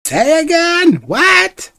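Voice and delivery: put-on very high, loud deaf old woman; loud old lady. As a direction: shout back like a deaf granny. Deaf herbalist misses the name.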